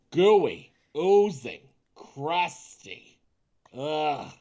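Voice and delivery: ordinary delivery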